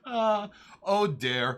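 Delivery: high, slow mock surprise; a drawn-out mock gasp at chat. Real, not acted. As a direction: stretch out oh dare in amused shock. Reacting to chat message.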